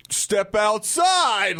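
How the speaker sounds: very drawn-out, high delayed reply; stunned slow response. Retold or imitated. Speechless bar patron answering the bartender, slow and stunned. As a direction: stretch each word as if dumbstruck. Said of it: "like, speechless".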